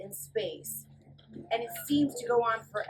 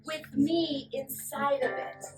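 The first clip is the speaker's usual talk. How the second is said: storybook suspense read; 3 semitones higher than usual. Acted. Teacher reading the launch to kids, building excitement. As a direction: read it like the rocket is lifting off.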